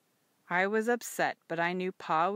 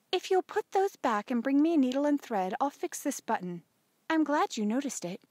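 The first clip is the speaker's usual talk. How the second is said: high-voiced practical mother; helpful mother; 7 semitones higher than usual. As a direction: pitch up as the mother giving instructions.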